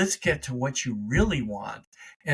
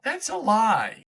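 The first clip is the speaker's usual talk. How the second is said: sudden realization; 7 semitones higher than usual. Acted. Self-talk on waking up to the lie, surprised realization. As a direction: catch yourself mid-thought, wide-eyed. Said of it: "wake up one day and say".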